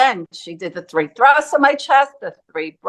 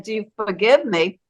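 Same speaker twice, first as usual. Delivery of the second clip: usual speaking voice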